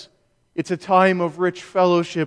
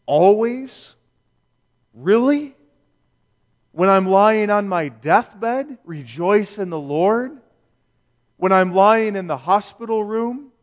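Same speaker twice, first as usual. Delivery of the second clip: voicing a doubter's questions, pitch lifting; puzzled, disbelieving questions with pauses between; incredulous questioning; 2 semitones higher than usual. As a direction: ask each one as a real challenge, rising at the end. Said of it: "does lead to questions".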